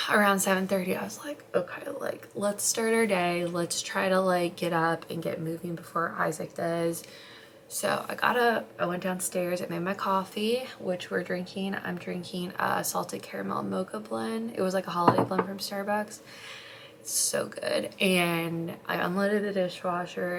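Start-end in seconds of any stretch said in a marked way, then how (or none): none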